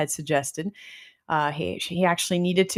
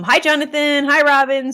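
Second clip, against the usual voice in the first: cheerful greeting; 8 semitones higher, 9 dB louder than usual. Real, not acted. Greeting live viewers.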